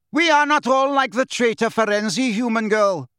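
high, indignant shout; angry shout. A narrator's reading. Grey centaur rebuking human girl, shouting. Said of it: "shouted the grey centaur".

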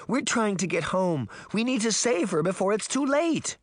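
high, anxious little-sister plea; beseeching, pitched well up; pleading. A narrator's reading. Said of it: "Daphne pleaded".

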